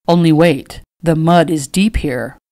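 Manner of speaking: lightly voiced gentleman; gentleman hero. A narrator's reading. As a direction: give a firm, gallant caution. Hero warns heroine of mud.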